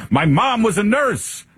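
high, put-on boastful candidate; mocking high-pitched candidate impression. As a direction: parody a politician's pitch, voice pushed way up. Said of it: "You'd think that he would say".

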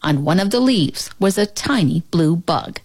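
ordinary delivery, nothing marked

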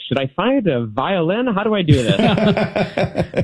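high, slow, laughing worry; mock worried. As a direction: play the fretful performer, laughing. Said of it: "being like".

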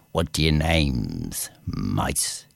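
low, slow questioning king; low cat king. Acted. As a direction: ask it down low like a big cat.

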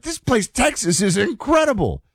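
mock-amazed tourist quote; gushing tourist. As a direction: gush like a wide-eyed visitor. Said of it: "I won't do their accents".

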